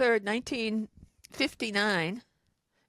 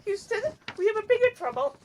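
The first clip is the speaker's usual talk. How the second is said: high, strained puppet frog voice; Kermit the Frog voice; 11 semitones higher than usual. Pretend. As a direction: squeeze it up like Kermit. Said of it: "Why are you Kermit the Frog voice?".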